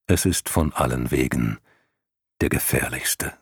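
low, ominous lion voice; grave lion warning. Acted. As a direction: warn darkly like a lion. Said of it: "sagte der Löwe". (tiefe, warnende Löwenstimme)

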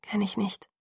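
soft, breath-only whisper. A narrator's reading. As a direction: whisper the admission. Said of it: "flüsterte ich".

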